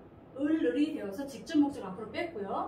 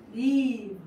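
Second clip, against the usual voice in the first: "live" loud and drawn out smarmily; greasy, cheesy; 9 dB louder than usual. Shown as a demonstration. Teacher finishing the memory chant, loud cheesy "live". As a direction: belt out a long, oozing LIVE. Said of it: "live는 느끼하게".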